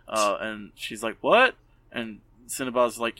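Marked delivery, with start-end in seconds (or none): 1.2-1.5 high, loud shocked what. Retold or imitated. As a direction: squeak out surprise. Foss shocked.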